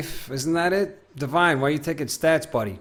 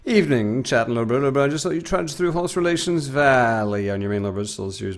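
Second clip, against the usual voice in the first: ordinary talk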